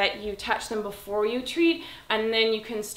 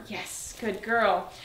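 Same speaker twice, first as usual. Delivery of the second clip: slow praise; much slower than usual. Real, not acted. Trainer praising her dog in the down. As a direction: stretch the praise calmly.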